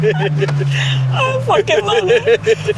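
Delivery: drawn out and breathless with thrill; can't contain the rush; real thrill, stretched slow; excited. Real, not acted. Thrilled reaction to boosted car.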